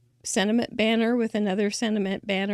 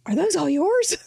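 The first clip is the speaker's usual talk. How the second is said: imitating an amazed clerk, higher and louder; amazed question; 7 semitones higher, 5 dB louder than usual. Mika the clerk marvelling at all the cards, surprised. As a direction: play the clerk gasping at the pile of mail.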